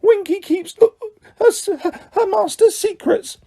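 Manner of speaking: very high, stubborn, slurred elf voice; high mutinous house-elf voice; mutinous squeak. A narrator's reading. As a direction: dig in like a defiant drunk elf. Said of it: "she said mutinously".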